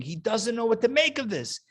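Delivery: ordinary talk, nothing marked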